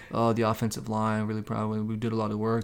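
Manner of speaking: low, soft droning athlete cliché; droning quarterback talk. Retold or imitated. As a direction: drone it like a bored quarterback. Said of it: "droning quarterback talk like".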